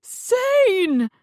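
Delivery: high, very loud scream of a name; woman screaming for Zane. Acted. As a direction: scream his name in fear. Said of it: "a woman screamed".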